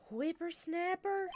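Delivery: put-on slow, high cranky old woman; old lady. Old lady scolding the boy, high and slow. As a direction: stretch it out like a crotchety granny.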